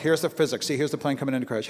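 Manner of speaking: normal talking voice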